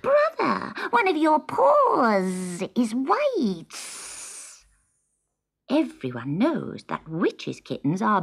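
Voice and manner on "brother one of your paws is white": high, slow little kitten voice with a tearful edge; a small kitten, close to tears; young kitten voice. Acted. Kitten speaks to her brother.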